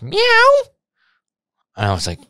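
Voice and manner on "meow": very high, loud cat meow; imitated loud meow. Retelling the woman in the alley, very high loud meow. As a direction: belt out a shrill cat meow. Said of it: "and then I just heard".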